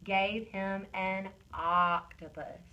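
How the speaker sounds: slow, "octopus" with a long first O; stretched O. Shown as a demonstration. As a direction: draw out the opening vowel of "octopus". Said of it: "let's stretch that O in each part".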